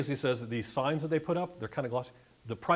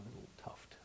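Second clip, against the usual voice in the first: whisper; 19 dB quieter than usual. Real, not acted. Pointing out detail in photo.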